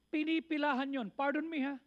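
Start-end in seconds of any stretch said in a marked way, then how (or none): none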